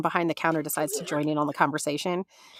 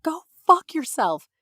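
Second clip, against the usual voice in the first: retold scream; 8 semitones higher, 4 dB louder than usual. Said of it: "I just screamed".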